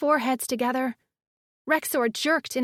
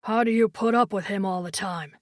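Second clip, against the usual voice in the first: deep male voice; 6 semitones lower than usual. A narrator's reading. Miko teasing, deep voice.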